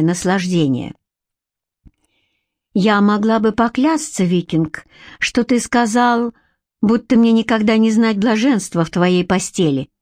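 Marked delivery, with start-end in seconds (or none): none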